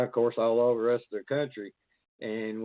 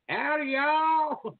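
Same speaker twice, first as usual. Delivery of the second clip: high, slow, drawn-out hillbilly howdy; Petey Merle hillbilly; 14 semitones higher, 3 dB louder, much slower than usual. Acted. Showing off his hillbilly alter ego to the host, slow high howdy. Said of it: "The Petey Merle coming out".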